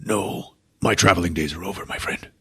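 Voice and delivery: soft, fading mock death rattle; a hammed-up last breath to his friend; dying farewell. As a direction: sigh it out like a dying hero. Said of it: "he's like".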